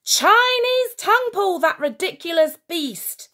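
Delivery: put-on high, loud kid battle cry; gleeful yell. A narrator's reading. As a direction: yell it with wild delight. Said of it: "screamed Granville".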